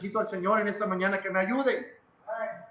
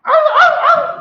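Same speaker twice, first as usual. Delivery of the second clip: loud, high yelping like a kicked street dog; 17 dB louder, slower than usual. Retold or imitated. Preacher imitating a street dog, loud high yelps. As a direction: yelp sharply like a hurt dog. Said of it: "como perro en la calle".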